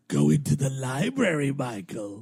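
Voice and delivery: slow, sly hint-giver; game narrator voice. Retold or imitated. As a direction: tease like a smug narrator. Imitating CD-ROM game narrator.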